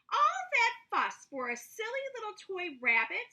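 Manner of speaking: high, loud, fussing mother voice; mother grumbling over a silly toy, high and loud; the boy's mother. A narrator's reading. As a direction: play her as an exasperated, scolding mum. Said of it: "said the boy's mother".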